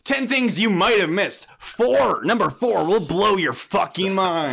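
hyped-up YouTuber countdown voice; clickbait video narrator. Pretend. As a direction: sell it like a clickbait list video. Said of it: "Save it for your YouTube video".

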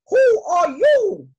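very high, loud, slow royal question; queen questioning. Acted. Queen questions young bee.

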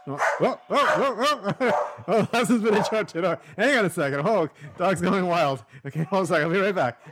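high laughing talk; laughing, flustered. Real, not acted. Podcaster interrupted by the dog going wild, laughing.

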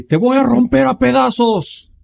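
high, loud crazed villager yell; villager shout. Retold or imitated. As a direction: scream it like a frenzied villager. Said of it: "cómo gritaban esos güeyes".

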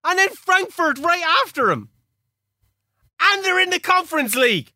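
high-pitched excited exclamation. Real, not acted. Manager reading out his fixtures, excited. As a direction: cry out each discovery, pitched high. Gleeful reaction to fixture list.

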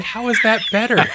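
high, incredulous hammed-up complaint; exasperated disbelief. Hero baffled that the crowd fears aliens, squeaking indignantly. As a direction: throw up your hands in disbelief. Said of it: "And he's like".

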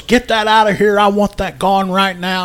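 loud, high furious order; angry demand. Retold or imitated. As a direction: shout it, you want it gone now. Brothers argue over doll.

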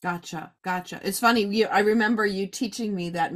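usual speaking voice

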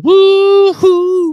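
high, loud whoop; excited whoop. Pretend. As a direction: let out a party whoop. Whooping after song line.

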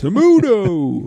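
high, slow, drawn-out "Tomuto" like a sumo ring call; sumo ring announcer. Pretend. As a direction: stretch out "Tomuto" like a ring announcer calling a wrestler. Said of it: "Coming out now".

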